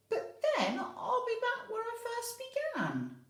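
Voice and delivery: put-on old lady baffled by the advice; laughing old lady. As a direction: protest with a chuckle.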